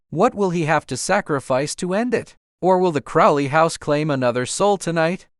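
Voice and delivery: plain reading voice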